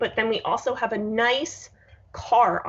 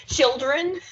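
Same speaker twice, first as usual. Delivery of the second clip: mock stern call, higher and louder; aggressive call; 5 semitones higher, 4 dB louder than usual. Cohost mocks her aggressive call to her future children. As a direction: call the kids like a bossy parent. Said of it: "what you're going to do to your children".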